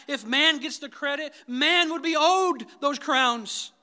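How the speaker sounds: impassioned sermon climax, loud and high; full-force preaching. Acted. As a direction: preach it hard, rising to the rafters.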